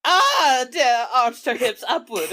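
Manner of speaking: normal talking voice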